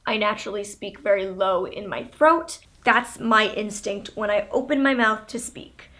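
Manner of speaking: ordinary talk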